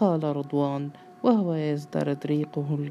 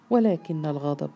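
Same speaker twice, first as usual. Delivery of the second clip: ordinary delivery, nothing marked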